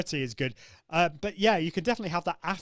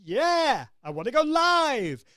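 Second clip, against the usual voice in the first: much higher and louder, arching up on each bit; high part of voice; 10 semitones higher, 7 dB louder, slower than usual. Shown as a demonstration. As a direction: sing it out in the top of your voice. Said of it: "using the high part of my voice".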